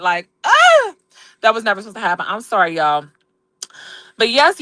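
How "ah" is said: high yelp of frustration; mad groan. Real, not acted. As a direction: burst out in an exasperated high cry. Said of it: "I am so mad".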